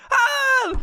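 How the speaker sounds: very high mock scream; high yelp of pretend terror; comic terrified scream. Stoner told he will die, screaming.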